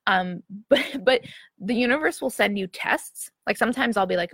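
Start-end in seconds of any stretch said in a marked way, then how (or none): none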